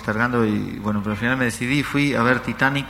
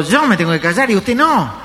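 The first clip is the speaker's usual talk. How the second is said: high, loud offended retort; acted indignant woman; indignant question; 8 semitones higher, 5 dB louder than usual. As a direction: throw it back, outraged. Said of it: "ella le preguntó".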